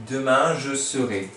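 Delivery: "Demain, je serai" said slowly and clearly; slow, careful "je serai". Shown as a demonstration. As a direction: spell out "serai" by ear. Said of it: "on rajoute toujours ce E R".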